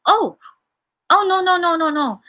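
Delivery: squeaky hurried no no no, backtracking; eager backpedal. Retold or imitated. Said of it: "He suddenly says".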